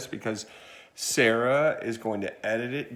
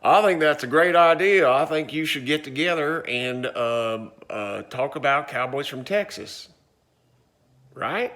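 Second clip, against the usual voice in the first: higher, louder Slim voice in a Texas accent; hammed-up Texas cowboy Slim; second cowboy Slim; 4 semitones higher, 5 dB louder than usual. Shown as a demonstration. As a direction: play the second cowboy answering. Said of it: "I've lost my Texas accent".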